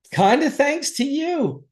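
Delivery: high, drawn-out mock thank-you; exaggerated thanks. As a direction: pitch it way up and stretch it out. Acting out thanks to the cop.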